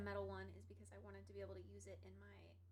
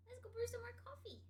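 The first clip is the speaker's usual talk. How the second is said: pet voice; 11 semitones higher, faster than usual. Real, not acted. Baby talk to cat, corrected.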